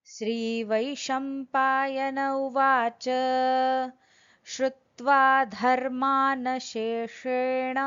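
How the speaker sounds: slow, measured Sanskrit chant; over enunciating. Acted. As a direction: recite every syllable carefully and slowly. Sanskrit verse recited before translation.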